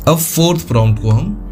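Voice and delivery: ordinary delivery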